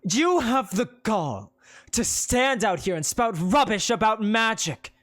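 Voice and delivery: seethe each word at him; Penelope threatening the preacher; gritted-teeth anger. Acted. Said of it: "She grits her teeth".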